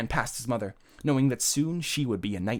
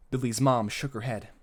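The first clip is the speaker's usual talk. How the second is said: plain reading voice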